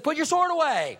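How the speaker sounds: firm command. Retold or imitated. Jesus orders Peter to stop, high and loud. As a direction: stop him sharply.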